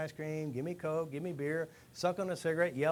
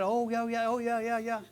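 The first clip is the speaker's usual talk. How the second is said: higher, louder, eager mock yes; excited agreement; 7 semitones higher, 5 dB louder than usual. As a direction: act the hooked guys agreeing eagerly. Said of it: "and they said".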